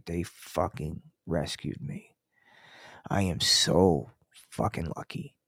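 real sincerity, quiet and slow; serious and sincere. Real, not acted. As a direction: drop to a hushed, heartfelt thank-you. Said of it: "And I'm just gonna be serious for a second".

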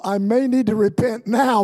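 normal talking voice

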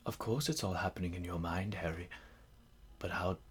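low, hushed, measured wizard's voice; Dumbledore. Retold or imitated. Wise headmaster to Harry on the heaven train platform, gently imitated. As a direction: drop it soft and low, with long pauses. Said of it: "And Dumbledore's like".

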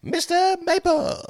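high, slow cartoon voice; character voice. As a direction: say it like a cartoon mascot. Mascot name said in character.

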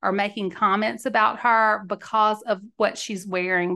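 ordinary delivery

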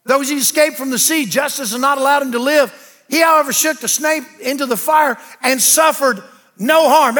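loud, high preacher cadence driving the verse home; preaching at full force. Acted. Preacher reading Acts to the church, loud and high. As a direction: thunder the scripture at the congregation.

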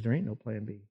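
Jesus answering the angel, quietly final; quiet, firm reply. Acted. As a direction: say it calmly as the last word. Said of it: "Jesus says".

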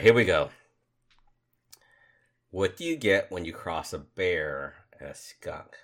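ordinary talk, nothing marked

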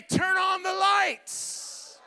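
slow, drawn-out shout; call and response. Shown as a demonstration. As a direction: lead the crowd in the line.

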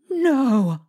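sudden loud cry of fear. A narrator's reading. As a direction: cry out in panic. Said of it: "she cried out".

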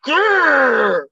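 loud, high growl of exasperation; frustrated growl. Real, not acted. Frustrated at the listed point totals.

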